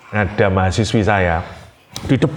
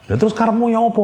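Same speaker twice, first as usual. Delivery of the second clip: angry question; 11 semitones higher, much faster than usual. Retold or imitated. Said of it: "saya marah sama dia".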